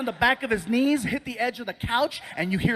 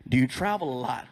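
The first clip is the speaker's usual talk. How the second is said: very low doctor voice; 10 semitones lower than usual. Retold or imitated. Doctor questioning the gastric bypass patient, very low voice. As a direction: drop the pitch right down as the doctor. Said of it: "And then he asked".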